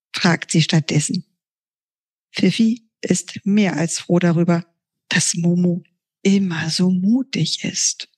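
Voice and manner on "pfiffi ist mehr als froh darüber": ordinary delivery, nothing marked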